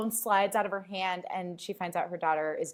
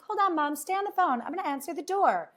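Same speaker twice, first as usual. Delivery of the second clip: higher daughter voice, casual; high voiced daughter; 9 semitones higher than usual. Daughter telling her mom to hold on, high voice.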